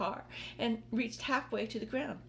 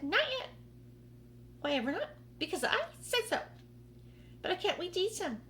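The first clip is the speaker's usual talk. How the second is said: high, insistent voices; squabbling aunts; 10 semitones higher, faster than usual. Acted. The aunts bickering about eating the peach, high insistent voices. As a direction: argue over eating it.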